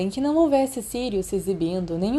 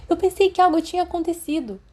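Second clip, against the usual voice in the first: put-on higher, anxious boy's voice; worried Peter; 10 semitones higher, 3 dB louder than usual. Peter worried his friend got caught, anxious higher voice. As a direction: fret about what might have happened.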